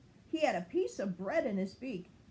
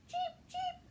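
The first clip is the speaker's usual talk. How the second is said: very high tiny chirping cheep; gosling chirp; 4 dB quieter than usual. Acted. Fuzzy begging his new friend to stay, very high chirps. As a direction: peep it like a baby goose.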